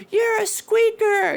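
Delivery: very high squeaky child mimic; mock prepubescent gamer squeal; squeaker kid voice. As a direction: squeak it like a little kid on mic. Mocking kid voice on Xbox.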